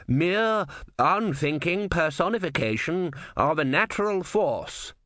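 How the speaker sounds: high, slow declamation. A narrator's reading. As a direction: pronounce it high and drawn out, for all to hear. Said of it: "in a louder voice".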